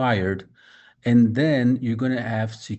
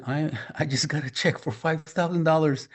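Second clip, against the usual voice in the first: laughing happily; 5 semitones higher than usual. Retold or imitated. Retold happy lady's words.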